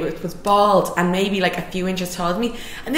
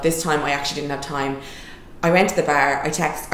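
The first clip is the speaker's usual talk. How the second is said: ordinary delivery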